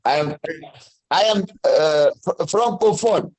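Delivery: ordinary delivery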